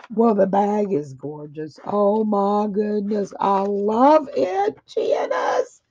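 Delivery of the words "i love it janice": high, near-tearful cry of delight; emotional squeal. Real, not acted. Crafter thanking Janice for the bag, near-tearful delight. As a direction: cry out your thanks, overwhelmed.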